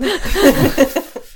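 silly laugh. Real, not acted. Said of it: "Sorry about my funny laugh".

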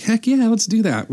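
keen fresh graduate saying yes to beers; eager yes. Retold or imitated. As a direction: jump at the offer. Eagerly accepting beer invite.

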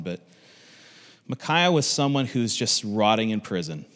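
ordinary talk, nothing marked

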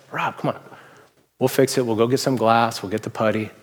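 put-on calm a scared kid down quietly; a hint of a kind, unbothered dad; gentle dad. Dad reassures scared son.

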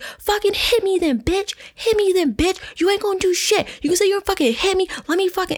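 high-pitched taunting rant, retold; hotheaded mock yelling in a high voice; re-enacted going off. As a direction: act out daring him to swing, pitch way up. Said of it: "going off".

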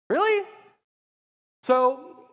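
high, loud retort in disbelief; incredulous. Retold or imitated. Son answering his dad's anger at church-going, incredulous. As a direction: act out a teen's indignant challenge. Son challenges angry dad.

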